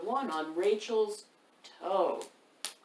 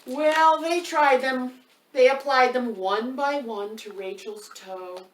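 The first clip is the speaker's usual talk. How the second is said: louder storytelling; 3 semitones higher, 8 dB louder than usual. A narrator's reading. Reading louder for the trials.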